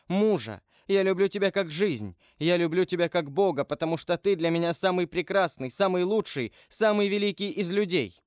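high, fervent declaration of love; Haydée, impassioned. Acted. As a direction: pour out love in a high, breathless rush of phrases.

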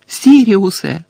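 high, loud desperate call; yelled out, falling at the end; shouting. A narrator's reading. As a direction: cry the name out across the room. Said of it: "закричав Гаррі".

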